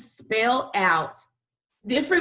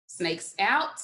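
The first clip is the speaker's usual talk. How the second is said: ordinary talk, nothing marked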